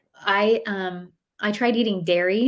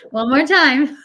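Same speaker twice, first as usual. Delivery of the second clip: louder, higher playful insistence; mock firm mom; 7 semitones higher, 7 dB louder, slower than usual. Mom to kids at the poop test, mock insistent. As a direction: push them gently but firmly, half laughing. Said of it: "I'm like".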